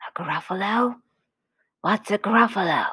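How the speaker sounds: whispery snake voice. Acted. Snake's lines in a story.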